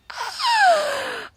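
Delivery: high, loud, drawn-out squeal; excited squeal. Real, not acted. Author thrilled about a long-held book idea, squealing. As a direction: let out a real squeal of excitement. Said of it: "I'm so excited".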